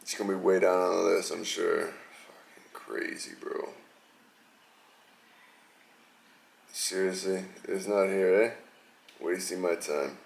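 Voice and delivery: low, soft grumbling under his breath; muttering to himself. Real, not acted. To himself while searching for the video, annoyed muttering. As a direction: mumble it while hunting for a clip.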